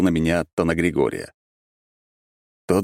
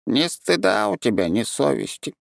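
reproachful creature; 6 semitones higher than usual. A narrator's reading.

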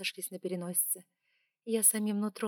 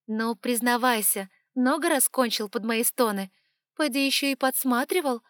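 loud mocking taunt; 4 semitones higher, 8 dB louder than usual. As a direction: goad him with scorn, loud. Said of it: "heroine taunts the dragon".

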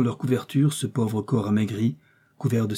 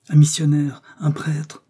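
higher, louder cry of discovery; raised-voice outcry; exclaiming; 4 semitones higher, 5 dB louder than usual. A narrator's reading. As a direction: exclaim it in startled recognition. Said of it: "s'écria Joe".

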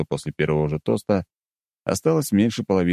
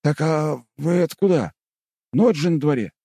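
worried; 11 semitones higher than usual. Acted. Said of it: "с тревогой в голосе".

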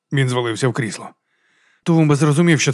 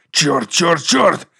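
rising to a higher angry final curse; angry cursing; 4 semitones higher, 3 dB louder, much slower than usual. A narrator's reading. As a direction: curse in mounting anger. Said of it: "Воткінс налився кров'ю".

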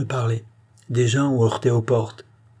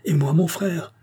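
high, lightly voiced plea; crying out; 7 semitones higher than usual. A narrator's reading. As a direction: raise the pitch in a mild cry. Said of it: "cria madame Élisabeth". (cri aigu mais retenu)

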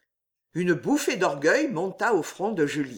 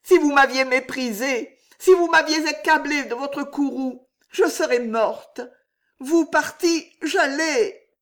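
voix aiguë et forte, passionnée; Juliette dramatique; 8 semitones higher, 4 dB louder than usual. A narrator's reading. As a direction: joue la tragédie avec ardeur. Juliette voiced by narrator. (Juliette dramatise devant le roi, aiguë et forte)

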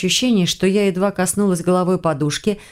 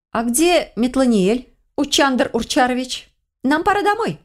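higher-pitched, drawn-out query, lifting at the end; brisk call to companions; 7 semitones higher than usual. A narrator's reading. Heroine calling her broom and cat home, high questioning call. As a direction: call out the missing ones in a high voice.

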